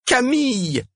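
high, wailed name; moaning. A narrator's reading. Cosima climbing on stage to Camille, moaning. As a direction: moan it out, very high. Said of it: "gémit Cosima".

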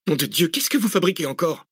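high-pitched, exasperated demand; exasperated outburst. A narrator's reading. Researcher objecting to the driver's sudden turn, exasperated. Angry protest at sudden detour.

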